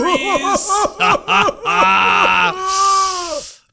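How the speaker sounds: long, loud, high cackle; hammed-up cackle. Host capping the Halloween series intro, hammed-up cackle. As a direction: let out a huge wailing horror-host laugh. Over-the-top laugh after announcing title.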